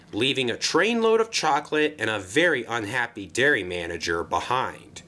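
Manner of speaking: ordinary delivery, nothing marked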